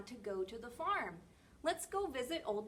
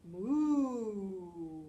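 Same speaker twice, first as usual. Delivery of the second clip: long, falling, lowered moo; cow moo imitation; 2 semitones lower, 7 dB louder than usual. Shown as a demonstration. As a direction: moo like a cow, letting it sink. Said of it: "A cow says".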